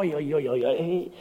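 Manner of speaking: ordinary delivery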